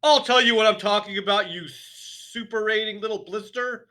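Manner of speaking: high, very loud rant; raging headmistress; shouted. A narrator's reading. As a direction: shout him down. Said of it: "the Trunchbull shouted".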